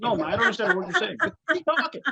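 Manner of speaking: quick, rising playful translation; amused translation. Retold or imitated. Translating his joke reply to mother-in-law, amused. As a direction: toss off the punchline brightly. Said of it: "which basically translates".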